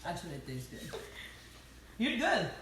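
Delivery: talking while chewing; words round a bite of food; mouth full. Real, not acted. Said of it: "talking with my mouth full".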